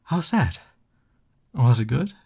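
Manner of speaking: bright, eager questions; eager excitement. Real, not acted. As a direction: bounce with hope for praise. Eager for partner's approval.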